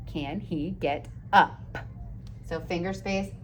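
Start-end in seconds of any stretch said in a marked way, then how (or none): 0.1-1.8 slow sounding out. Shown as a demonstration. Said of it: "Slow down and take it slow".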